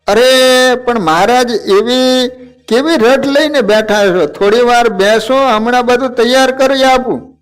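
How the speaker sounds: put-on an old woman fussing at her guest; insistent protest. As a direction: coax him to sit and wait. Karniba protests Maharaj's hurry.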